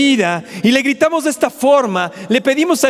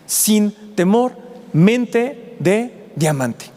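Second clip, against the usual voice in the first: drawn out, weighty words; slow emphatic slogan; 3 semitones lower, much slower than usual. Real, not acted. Preacher to the church, slow and weighty. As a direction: spell out the motto slowly.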